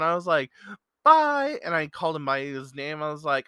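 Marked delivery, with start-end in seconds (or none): 1.0-1.6 high, loud, cheery farewell call; calling out goodbye. Retold or imitated. Man calling bye to a young fan down the checkouts, loud and high. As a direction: call it out across a store.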